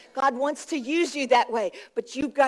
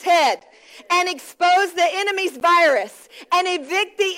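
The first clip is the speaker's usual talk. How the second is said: emphatic preaching in measured phrases; preached declaration; 3 semitones higher than usual. Real, not acted. Preacher declares faith over the congregation, emphatic. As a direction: proclaim it phrase by phrase, punching key words.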